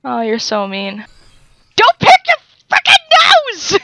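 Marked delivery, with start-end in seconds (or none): 1.8-3.8 high, loud playful yell; over-the-top squealed scolding; mock angry shout. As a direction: scream a pretend telling-off. Mock fight with game guard resumes.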